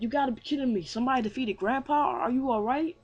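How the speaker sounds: high, worried incredulity; mock shock. Hero checking on his beaten grandpa, shocked concern.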